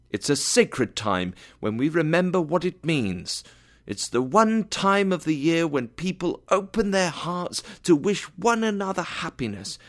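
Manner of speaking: high, heartfelt defence; fervent speech. A narrator's reading. Nephew's earnest speech praising Christmas.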